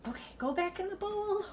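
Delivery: high, soft little voice; high-pitched coaxing. Real, not acted. Woman coaxing mouse back to bowl, high and soft. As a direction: coax a small animal, softly and high.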